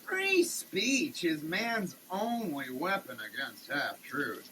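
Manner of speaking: normal talking voice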